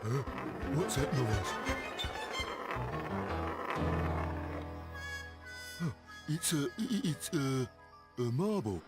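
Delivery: stuttering, frightened henchman; nervous stammer. Acted. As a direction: stammer in panic.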